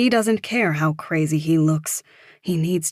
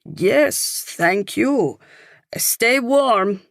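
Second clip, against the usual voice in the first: higher-pitched parting call; 6 semitones higher, 4 dB louder, much slower than usual. A narrator's reading. Parting from a stranger in the cold, calling out. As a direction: call it out as a goodbye.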